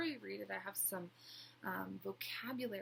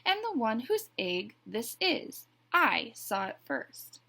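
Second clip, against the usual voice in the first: louder, self-important claim; puffed-up pride; 3 semitones higher, 11 dB louder than usual. A narrator's reading. Duck claims the egg before Goose, puffed-up pride. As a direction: stake your claim, slightly pompous. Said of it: "puffing out his feathered chest".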